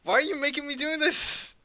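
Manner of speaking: high, loud protest of dread; distressed wail. Real, not acted. Player begging game master over the hand, distressed wail.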